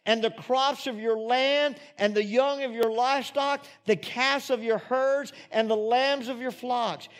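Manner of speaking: high, loud scripture proclamation; ringing, driving preacher's delivery; full-force preaching. Acted. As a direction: proclaim the blessings to the whole congregation at full force.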